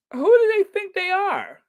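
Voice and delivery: hammed-up indignant squeak; mock indignant squeal. Repeating mock outrage while waiting for a tote bag.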